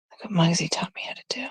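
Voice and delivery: fast, hushed whisper; excited secret whisper. Real, not acted. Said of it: "Why am I whispering?".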